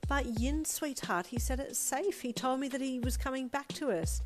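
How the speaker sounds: high-pitched motherly pleading; pleading reassurance. A narrator's reading. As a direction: insist that all is fine.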